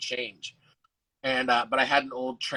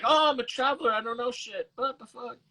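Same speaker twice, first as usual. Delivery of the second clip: high-pitched clueless traveler act; 10 semitones higher than usual. Acted. Performer feigning ignorance to dodge the fare, high clueless-traveler voice. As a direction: play dumb tourist, pitched way up.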